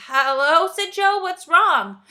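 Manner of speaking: loud, high startled call; sudden alarm at the engine noise; startled shout over the sputtering engine. Acted. Airplane makes a funny noise.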